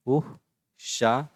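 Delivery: "उशाप" in isolated syllables with long gaps; slow syllable breakdown. Shown as a demonstration. Teacher repeating "उशाप" for pupils, slow syllable breakdown. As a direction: pull "उशाप" apart slowly, piece by piece.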